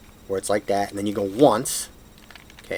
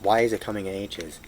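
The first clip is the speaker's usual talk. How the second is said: usual speaking voice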